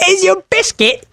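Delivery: very high, squeaky British caricature; British accent. Acted. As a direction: squeak it like a cartoon Brit. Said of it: "the British person comes over".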